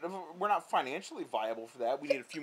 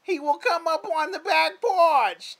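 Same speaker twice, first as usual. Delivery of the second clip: put-on much higher, louder, slow sing-song old lady; grandma voice; 11 dB louder, slower than usual. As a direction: coax him about the squirrel like grandma. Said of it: "what she actually sounds like".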